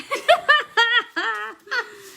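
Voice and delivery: high, loud burst of laughter; real laugh bursting out. Real, not acted. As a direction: crack up at your friend. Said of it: "Y'all don't laugh if she falls".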